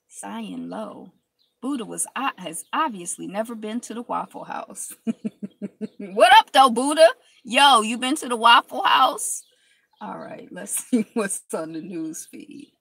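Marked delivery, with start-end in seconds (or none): 6.0-9.4 loud playful holler. Real, not acted. Teasing the Buddha, loud playful shout. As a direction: shout it up high and bright.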